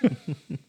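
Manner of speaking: short, quiet laugh; amused laugh. Real, not acted. Co-host suggests a Ninja six fifty, amused laugh. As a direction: chuckle softly at the suggestion.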